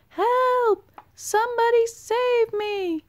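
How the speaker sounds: very high, loud desperate shout; shouting for help. A narrator's reading. Stranded bullfrog shouting for help in the flood, very high and loud. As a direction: scream for rescue. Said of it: "he shouted".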